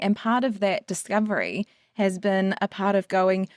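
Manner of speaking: normal talking voice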